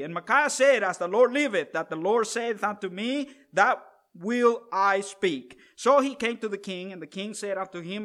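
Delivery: measured, solemn Bible reading; scripture reading. Acted. Preacher reading the kings' story to the congregation, solemn scripture reading. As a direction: read the old King James story with gravity.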